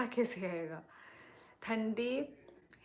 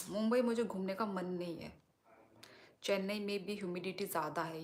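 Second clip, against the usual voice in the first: usual speaking voice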